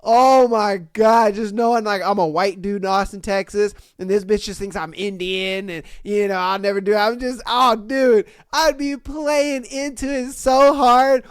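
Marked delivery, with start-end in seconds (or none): none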